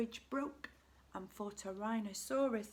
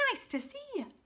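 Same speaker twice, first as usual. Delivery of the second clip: high, friendly hoot; hooted greeting; 10 semitones higher, 4 dB quieter, faster than usual. A narrator's reading. Duckbills greeting Drip by the river, high hooting. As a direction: hoot a sing-song welcome. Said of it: "and they hooted".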